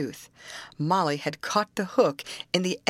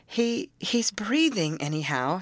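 usual speaking voice

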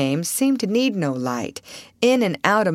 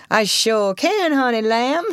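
slow, higher Southern drawl; chuckling old boatman; 6 semitones higher, slower than usual. A narrator's reading. Old boatman agreeing to pilot them, amused drawl. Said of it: "chuckled the old negro".

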